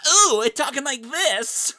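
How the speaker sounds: high, slow, squeaky cartoon villain. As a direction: make him sound like a silly cartoon baddie. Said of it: "he talks and he's like".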